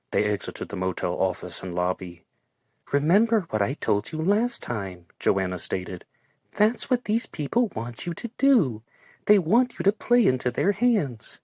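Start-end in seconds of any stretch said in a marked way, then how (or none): 2.9-11.4 raised, lighter woman's voice; Joanna's voice. A narrator's reading. Joanna warning Barry not to play along, raised voice. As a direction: voice Joanna high and earnest.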